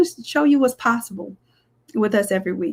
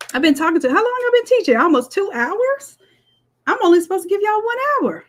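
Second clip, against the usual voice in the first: pitched up high in real surprise at the time; surprised realization; 9 semitones higher than usual. Real, not acted. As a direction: suddenly realize how long it's been. Realizes class ran two hours.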